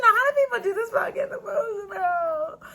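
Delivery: pleading through tears; crying. Real, not acted. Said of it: "like, emotional".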